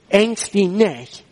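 slow, deliberate quotation; measured and solemn; emphatic scripture quote. Retold or imitated. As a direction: spell out the command slowly. Preacher quoting God's word emphatically.

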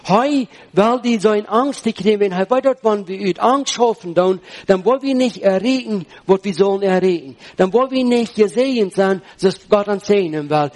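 ordinary talk

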